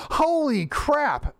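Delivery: slow, high, drawn-out amazement; stunned epiphany. Retold or imitated. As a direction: stretch it out, stunned by the discovery. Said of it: "like an idiot like me at two thirty in the morning going".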